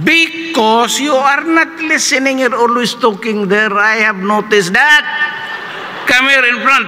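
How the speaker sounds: high, slow scolding professor voice; angry professor. Retold or imitated. Professor scolding talkative students, high and slow. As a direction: lecture the students sternly, drawn out. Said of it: "So galit talaga siya".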